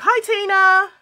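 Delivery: bright, high, loud hi; cheery greeting. Real, not acted. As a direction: call out a happy hi to a viewer.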